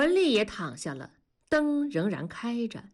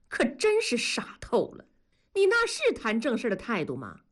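mock-heated wife, pitched much higher and fast; angry; 6 semitones higher than usual. Wife losing her temper at her husband, heated and high. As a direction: let the anger climb into a high pitch. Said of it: "文丽也火了".

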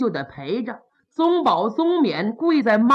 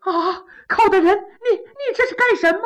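much higher frightened cry; startled princess; 8 semitones higher, faster than usual. Acted. Princess alarmed as Kou Zhun opens the coffin, high and frightened. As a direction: gasp and shriek in alarm.

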